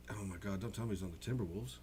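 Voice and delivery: ordinary delivery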